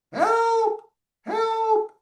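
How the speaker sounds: very high squeaky duck cry for help. Acted. Teacher reading to children, high duck voice. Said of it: "the voices were distinct for each animal".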